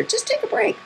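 imitated sister. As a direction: echo the sister in a high, softened pitch. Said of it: "my sister".

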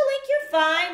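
playful reassurance to a frightened game hero; mock reassurance. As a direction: cheerfully tell Link he's fine. Reassuring Link in pretend dialogue.

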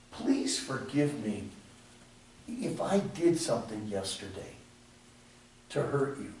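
soft, low, pleading mock apology; hushed mock apology. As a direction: play the timid, vague apology quietly. Preacher acting out wrong apology.

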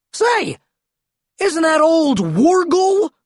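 high, loud cry of recognition; high, drawn-out excited question; exclaiming. A narrator's reading. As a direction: exclaim as if spotting an old pal. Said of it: "I exclaimed".

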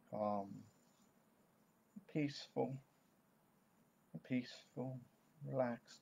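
soft, slow reassurance with long pauses; calming. Shown as a demonstration. Coach showing parents soothing statements for an anxious child, calming. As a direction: soothe with unhurried, gently spaced words.

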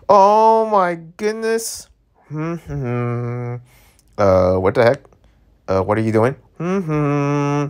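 usual speaking voice

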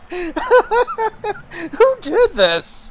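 cracking up, high-pitched and loud; laughing. Real, not acted. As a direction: squeal the question out through laughter.

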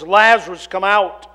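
calling loudly into the tomb. A narrator's reading. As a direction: call him out with full voice. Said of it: "called out in a loud voice".

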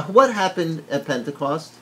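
ordinary delivery

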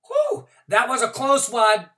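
put-on high, loud, fast kid chatter; relieved excitement. A narrator's reading. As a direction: gush with relief and plans. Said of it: "I said".

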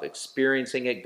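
ordinary delivery

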